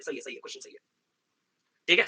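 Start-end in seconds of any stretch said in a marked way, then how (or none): 0.0-0.8 whispered admission; teacher quietly admitting the question was right; under breath. Real, not acted. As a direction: concede it softly to yourself.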